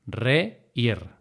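"reír" broken into re, ír; syllable by syllable. Shown as a demonstration. Teacher repeating the hiato split, syllable by syllable. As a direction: say "reír" as two separate syllables. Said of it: "para marcar ese hiato".

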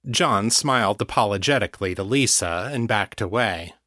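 usual speaking voice

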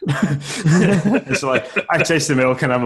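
talking through laughter; laughing. Real, not acted. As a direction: laugh while you tell it. Laughing while retelling.

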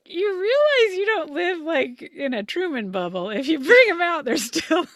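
high, loud mock lecture with a laugh breaking in. Imitating telling the neighbor off about squirrels, loud high mock scoff.

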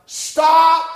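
high, loud single-word blast; shouted command. Acted. Preacher voicing the Spirit's order to stop weeping, shouted command. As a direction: belt it out as an order.

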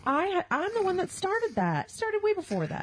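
high, slow indignant protest. Real, not acted. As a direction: insist indignantly. Indignant insistence.

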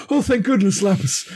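high, relieved cry; high relieved greeting. Acted. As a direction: sigh with relief at a friend. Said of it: "[name] is gonna go".

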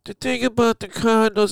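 high, nose-pinched broadcaster voice. Retold or imitated. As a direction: talk through pinched nostrils. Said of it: "I pinch my nose".

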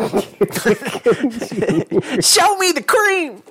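high squealed movie catchphrase; mock Cuba Gooding Junior yelp. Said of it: "Cuba Gooding Junior".

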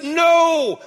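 Jesus shouting no to the disciples; rebuking no. Retold or imitated. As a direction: rebuke them with one sharp no.

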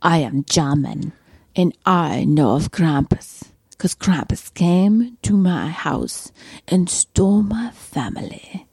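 shaky attempt at a German accent. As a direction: attempt a slow German accent that drifts toward Russian. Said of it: "I can't do an accent to save my life".

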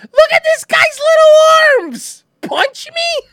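very high, slow squealing laughter; hysterical mockery. Real, not acted. Streamer mocking the man's little arms, in hysterics. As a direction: shriek it, helpless with laughter.

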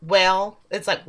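ordinary talk, nothing marked

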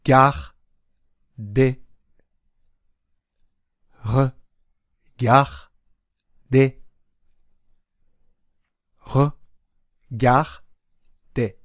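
Regarder split into re, gar, de with long gaps; slow, syllable by syllable. Shown as a demonstration. Teacher leading slow pronunciation practice, Regarder in three separated syllables. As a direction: sound out Regarder slowly, one syllable at a time. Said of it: "ゆっくり発音してみましょ".

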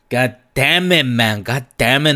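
mock-dramatic dread of strong weed; exasperated groan. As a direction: throw up your hands at it. Dreading sativa, grumbling.

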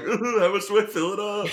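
high, mock-helpless voice; lost and clueless. Cook retelling her confusion over a recipe, mock clueless. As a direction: imitate yourself flustered and lost. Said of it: "I was so lost last time".

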